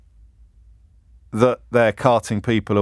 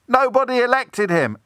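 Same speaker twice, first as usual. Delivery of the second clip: mock heckler shout; 10 semitones higher than usual. Protester at the proclamation calling out, high mock shout. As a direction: mimic a protester calling out over a crowd. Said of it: "You shout".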